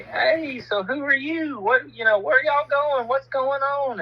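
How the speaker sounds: a high, eager chorus of relatives quizzing him; chatty in-laws firing questions; nosy family greeting. Retold or imitated. Family rushing out to meet the new guy, high and eager.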